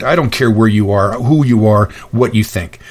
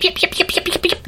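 high squeaky yapping, soft and quick; tiny dog yapping from inside a car; imitated little dog yips; 6 dB quieter than usual. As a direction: yip like a tiny lapdog. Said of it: "I'm hearing yip".

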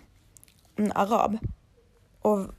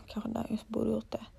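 barely voiced murmur to herself; a sheepish whispered afterthought; whispered aside; 11 dB quieter than usual. Real, not acted. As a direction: drop to a breathy aside, almost unheard. Muttered aside about painkillers.

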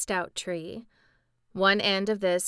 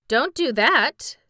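slow, louder protest; remonstrating; 5 semitones higher, 9 dB louder, slower than usual. A narrator's reading. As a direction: object firmly and slowly. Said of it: "remonstrated the sawhorse".

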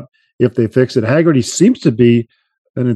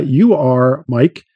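ordinary delivery

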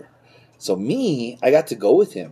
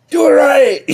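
high, loud mock yell of an angry dad; 6 dB louder than usual. Son imitating his dad yelling at him over the car, mock yell. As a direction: bark it like a strict father.

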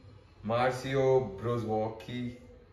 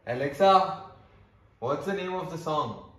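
calling out; 6 semitones higher, 7 dB louder than usual. Real, not acted. Calling out to smart speaker.

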